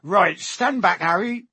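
booming, hearty giant; Hagrid voice. A narrator's reading. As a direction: hurry him along cheerfully.

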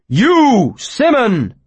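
loud, high theatrical accusation; dramatic wizard. Acted. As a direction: point and proclaim like a stage wizard. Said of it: "his voice lost its dramatic resonance".

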